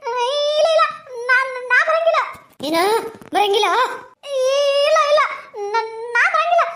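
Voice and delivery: high, pleading village woman voice, close to tears; frightened woman. Acted. A scared woman begging the ghost to leave her, high tearful plea.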